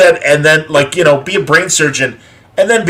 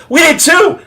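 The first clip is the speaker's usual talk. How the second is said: put-on excited young voice; 3 dB louder than usual.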